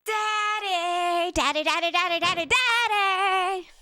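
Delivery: put-on high, excited child calling out; child calling daddy. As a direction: call for daddy like an eager child.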